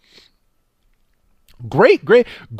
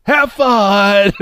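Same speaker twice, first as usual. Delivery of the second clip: mock grandpa cheer; 7 semitones higher than usual. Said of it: "He's like".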